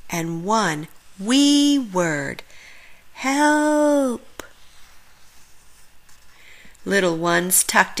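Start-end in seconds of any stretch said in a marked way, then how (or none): 3.1-4.4 Santa's call for help. Acted. Santa stuck calls for help.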